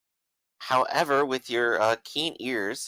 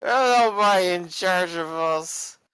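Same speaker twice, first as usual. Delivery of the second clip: put-on higher, slurred drunk voice; drunken slur; 8 semitones higher, 4 dB louder, slower than usual. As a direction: slur it like you can barely stand. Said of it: "he says as he kind of drunkenly slurs".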